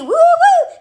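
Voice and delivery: high, drawn-out playful whoop; granddad's whoop. Retold or imitated. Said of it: "He used to say".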